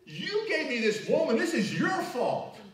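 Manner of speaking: high, loud accusing cry; blaming God. Retold or imitated. Adam blaming God for the woman, high loud indignant cry. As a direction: point the finger at God in indignation. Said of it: "and then he blamed God".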